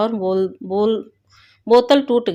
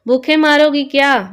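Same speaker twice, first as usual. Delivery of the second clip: raised, higher-pitched hungry complaint; loud scolding shout; mother-in-law yelling; 5 semitones higher, 6 dB louder than usual. A narrator's reading. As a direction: yell it out like a cranky old woman demanding food. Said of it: "फिर सास चिल्लाई".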